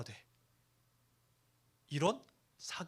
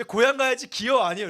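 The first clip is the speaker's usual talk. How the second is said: Chungcheong dialect; 7 semitones higher, 12 dB louder than usual. Shown as a demonstration. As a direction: play up the Chungcheong hometown accent, loud. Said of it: "이렇게 합니다". (큰 소리로 과장된 충청도 사투리)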